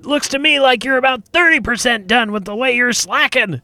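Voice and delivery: high, loud sneering goblin; scolding goblin. Acted. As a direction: chew out a lazy worker. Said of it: "Scrick, the goblin, goes".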